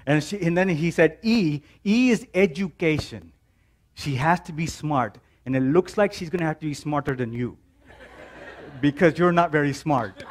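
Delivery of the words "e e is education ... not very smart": put-on Indian accent. Dad telling his son she must be smarter, Indian accent. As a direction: poke fun at the son in a dad's Indian accent. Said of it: "I'm gonna throw in the Indian accent".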